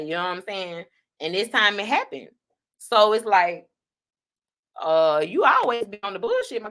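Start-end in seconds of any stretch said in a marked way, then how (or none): none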